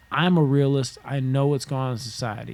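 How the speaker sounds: usual speaking voice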